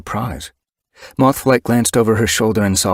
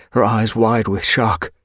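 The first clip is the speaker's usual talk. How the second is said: plain reading voice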